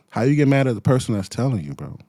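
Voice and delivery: ordinary talk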